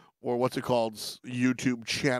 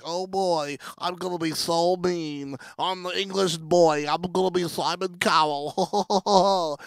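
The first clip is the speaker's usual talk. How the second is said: higher-pitched put-on English boy, stretching the last words; 5 semitones higher, slower than usual. Host imitating an amped contestant judge, gleefully boastful. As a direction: imitate an eager wannabe villain in an English accent.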